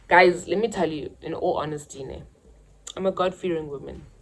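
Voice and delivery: ordinary talk, nothing marked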